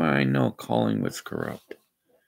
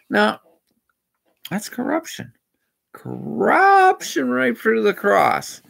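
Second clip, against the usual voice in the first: indignant; 10 semitones higher, 4 dB louder than usual. Real, not acted. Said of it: "And I'm ranting".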